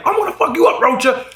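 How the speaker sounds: high-pitched acted threat; mock threat. An angry guy in a bar threatens the comic, acted-out threat. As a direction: threaten someone, voice jumping up high.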